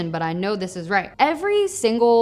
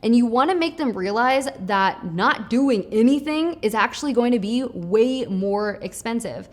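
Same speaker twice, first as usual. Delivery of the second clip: normal talking voice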